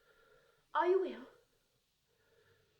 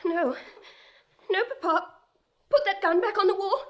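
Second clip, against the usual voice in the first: high, louder pleading; frightened plea; 6 semitones higher, 3 dB louder than usual. Acted. Daughter begs her father to put the gun away, high and pleading. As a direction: beg him to put the gun down.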